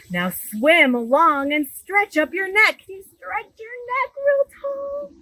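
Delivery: bright, lively storybook read-aloud; animated picture-book reading; reading to children. Acted. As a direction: read it big for a room of little kids.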